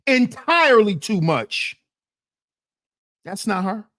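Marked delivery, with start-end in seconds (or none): none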